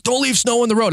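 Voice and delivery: high-pitched mock yell; retold yelling back. Man yells back at plow driver, high imitated yell. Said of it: "He's yelling back".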